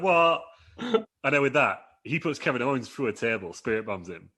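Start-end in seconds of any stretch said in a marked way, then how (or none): 0.0-0.4 mock surprise. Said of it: "everyone's like".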